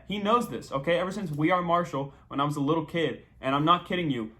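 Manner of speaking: ordinary talk, nothing marked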